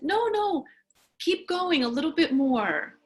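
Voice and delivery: put-on a narrated girl's eager protest. A narrator's reading. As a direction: voice a child who won't stop now. Girl eager to keep going.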